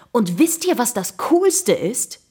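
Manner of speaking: high, loud, drawn-out teaser; eager, undeterred. A narrator's reading. Jakob carrying on unfazed to his friends, excited. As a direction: tease the big news excitedly. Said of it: "fuhr Jakob unbeirrt fort".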